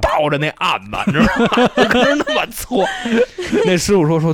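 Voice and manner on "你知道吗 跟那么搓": laughing through the words; can't stop laughing while telling it. Real, not acted. Funny story of clinging to table.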